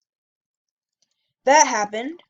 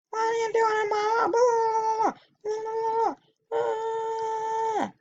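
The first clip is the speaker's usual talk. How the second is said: much higher, whiny put-on woman's voice; mock angry lady; 13 semitones higher than usual. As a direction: parody the lady at the door, high and wailing. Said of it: "this lady answered and said".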